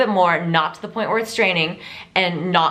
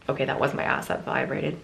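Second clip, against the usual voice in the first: low, softer aside; quiet aside; 4 semitones lower, 7 dB quieter than usual. Real, not acted. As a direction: mutter it to yourself. Phone buzzes, aside.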